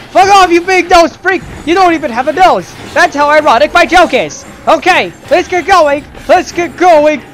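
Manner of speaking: high, loud frantic yelling; panicked shouting. Real, not acted. Chased, cursing.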